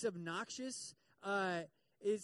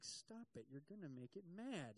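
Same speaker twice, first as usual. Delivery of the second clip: much softer, an urgent hushed warning; 3 semitones lower, 11 dB quieter than usual. Retold or imitated. Telling someone swatting a wasp to stop, in a hushed voice. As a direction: keep it down so the wasp stays calm.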